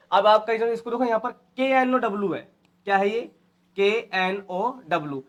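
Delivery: usual speaking voice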